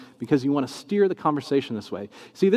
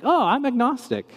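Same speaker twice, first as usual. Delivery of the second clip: 1 casual announcement; 9 semitones higher than usual. Retold or imitated. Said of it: "he was like".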